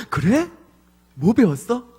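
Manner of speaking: high, eager mock delight; excited dad. Dad delighted by child's praise. (신이 난 아빠의 흉내)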